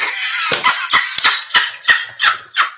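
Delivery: breathless, airless wheeze of laughter; silent wheezing laugh. Real, not acted. Host crying with laughter at the topic. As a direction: laugh so hard no sound comes. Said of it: "I have tears rolling down my face".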